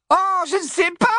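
very high, sarcastic shout; ironie vociférée. Acted. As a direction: yell it with fearsome irony. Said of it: "vociféra Hermione avec une ironie redoutable". (ironie redoutable, criée dans l'aigu)

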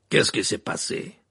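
loud, slow, alarmed demand; crying out. A narrator's reading. Karkaroff finds his student on the ground, crying out. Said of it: "s'écria-t-il".

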